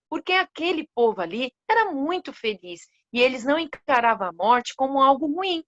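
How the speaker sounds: ordinary delivery, nothing marked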